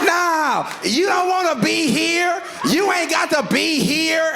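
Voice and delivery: high-pitched mock scolding; hammed-up wife shouting him away, stretching the words; angry wife. Wife arguing through a locked door, acted angry yell.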